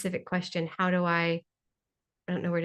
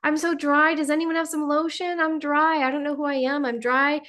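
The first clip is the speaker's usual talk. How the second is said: comic whine of a dried-out droplet; whiny water drop; 6 semitones higher than usual. Acted. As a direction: play a pitiful thirsty drop. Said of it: "the drop of water is like".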